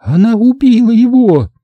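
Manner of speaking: high, loud accusing cry; выкрикнуть в ужасе; shocked outcry. A narrator's reading. (высокий громкий испуганный крик)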